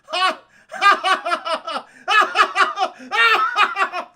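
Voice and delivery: ridiculous villain laugh; loud, high bursts of hammed-up cackling; Dennis Hopper. Villain gloating as worlds merge, ridiculous loud laugh. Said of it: "Dennis Hopper has this ridiculous laugh".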